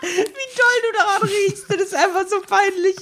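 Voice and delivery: high, slow teasing falsetto; mocking high voice. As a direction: squeal it out in a silly high voice. Mocking the other's sniffing.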